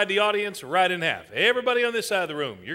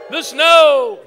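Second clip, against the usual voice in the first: loud, high stage call; booming raised cue; 5 semitones higher, 11 dB louder than usual. Pretend. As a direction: belt it out to the crowd. Calling out audience cue.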